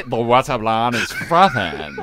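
slow mock American-South African accent; American-South African tech bro. Host parodying his colleague's impression of him, mock American-South African accent. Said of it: "I sound like an American South African tech bro".

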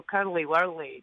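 broad Scottish demo, low and slow; Scottish accent. Shown as a demonstration. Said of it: "but we always go".

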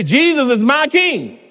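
emphatic declaration. Shown as a demonstration.

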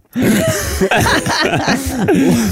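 host bursts out in a real, high laugh; sudden high-pitched laugh; high burst of laughter. Real, not acted. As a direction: crack up helplessly at the joke. Host cracks up at the story.

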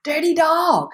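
high, teasing mock indignation; mock outrage. Teasing the shop girl about Fashion Week, in mock outrage. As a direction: playfully scold a lucky colleague. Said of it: "And I was like".

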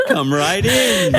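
high, slow, giggling clown voice; silly clown. Acted. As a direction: ham up a goofy doctor clown, laughing through it. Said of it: "That's your clown character".

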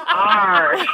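Ash stretched into a drawn-out Arsh. Pretend.

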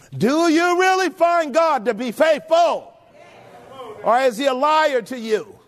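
rising preacher's rebuke; full-force preaching. Acted. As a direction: press them with each question. Preacher presses the congregation.

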